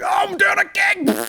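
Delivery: high, slow, loud cry of delight; mock ecstatic shriek; overjoyed. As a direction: squeal like you've won. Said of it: "And I was like".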